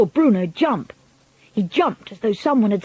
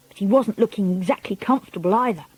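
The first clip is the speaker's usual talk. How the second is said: plain reading voice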